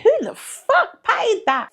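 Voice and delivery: can't believe anyone paid that; incredulous. Real, not acted.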